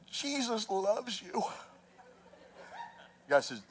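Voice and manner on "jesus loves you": very soft, slow, timid plea; shaking buddy facing the thugs, scared and soft; scared, much softer. Retold or imitated. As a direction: barely get it out, trembling with fear. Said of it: "he starts shaking".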